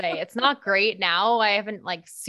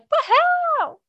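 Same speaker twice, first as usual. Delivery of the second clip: much higher, louder mock woohoo; imitating her woohoo; 6 dB louder than usual. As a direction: replay your own whoop, loud and high. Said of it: "except for my woohoo".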